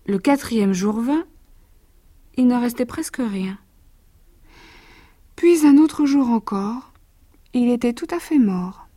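put-on lively verse read to a child; lecture de conte. A narrator's reading. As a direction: read it like a bedtime picture book. Reads the verse tale. (lecture animée de conte pour enfants)

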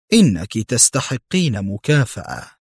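plain reading voice

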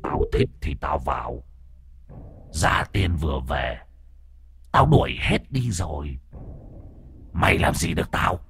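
a mild hint of a snarling spirit; vicious ghost. The ghost defies the master, lightly voiced low menace. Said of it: "hung ác nhìn cậu".

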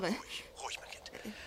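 soft, near-whispered calming; soothing hush. Acted. Husband soothes frightened wife on phone, soft hush. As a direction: settle her gently.

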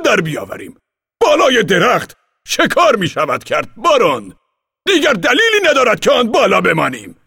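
high, loud voice of an eager commander. Acted. Commander urges the baron down, high loud and eager. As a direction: urge him down, full of plans.

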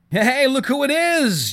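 high, slow call across the room. A narrator's reading. Jason calling to Judith as she enters, high and drawn out. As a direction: greet a friend from afar. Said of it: "Jason called".

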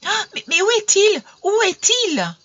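high, loud frantic cry; frantic outcry. A narrator's reading. Emelina leaning toward her absent beloved, frantic cry. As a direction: cry out desperately, searching for someone. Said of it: "elle s'écria".

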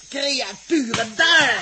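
loud, high, slow roar; furious shout. Acted. Dirk kicking the rats away, furious shout. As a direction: hurl it at the vermin.

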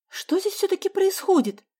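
put-on puzzled girl asking what happened; surprised. A narrator's reading. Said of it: "с удивлением произнесла Соня". (удивлённо, гораздо выше)

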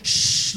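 long hushing shush. Retold or imitated. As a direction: imitate an old lady's shush. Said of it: "nos decía".

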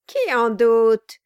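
loud, slow sneering retort; loud mocking cry at his wife; cried out mockingly. A narrator's reading. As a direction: throw her words back at her. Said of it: "s'écria le nain".

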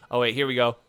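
ordinary talk

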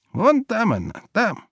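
put-on high old woman's voice; old peasant woman. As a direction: answer like a simple old peasant. Old peasant woman answers general.

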